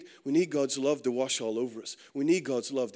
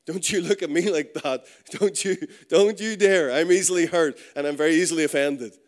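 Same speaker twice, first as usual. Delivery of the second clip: hammed-up indignation, building louder; touchy and offended; 5 dB louder than usual. Preacher playing someone easily hurt, mock-offended and forceful. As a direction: warn them off with mock outrage.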